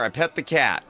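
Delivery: ordinary talk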